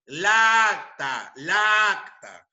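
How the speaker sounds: "la acta" alargado y fuerte, las dos aes chocando en una sola; cacofonía demostrada. Shown as a demonstration. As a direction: arrastra "la acta" despacio, fundiendo la a de "la" con la de "acta". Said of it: "va a sonar algo así". ("la acta" con una aaa larga, sostenida y enfática)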